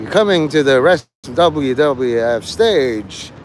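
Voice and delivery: slow, drawn-out ring announcer; Michael Buffer announcer. Pretend. Announcing a wrestler to the stage, slow hammed-up announcer. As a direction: stretch the name like a fight introduction. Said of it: "I feel like, uh, Michael Buffer".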